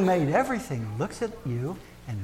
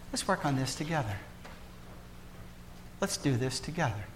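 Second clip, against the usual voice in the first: tender God voice; 15 dB quieter than usual. Acted. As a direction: lay it down softly like a hand offered.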